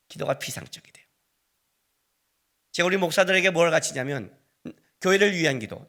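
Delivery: ordinary delivery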